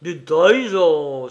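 loud, drawn-out exclamation; indignant outcry. A narrator's reading. Prince throwing an accusation back at Pierrot, indignant outcry. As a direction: throw the word back, slowly and loudly. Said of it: "s'écria Horribilis".

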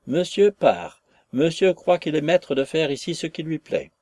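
hurlement furieux et moqueur; crie-le au nez de l'employé; patron en colère. A narrator's reading. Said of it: "hurla le patron". (patron qui hurle de colère)

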